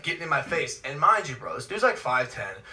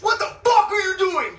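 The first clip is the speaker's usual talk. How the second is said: furious high yell at a stranger; angry shout; 4 dB louder than usual. Retold or imitated. Stranger yelling at Logan in the stands, angry shout. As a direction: bark it in his face. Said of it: "he stands up and goes".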